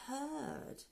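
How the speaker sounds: ordinary delivery, nothing marked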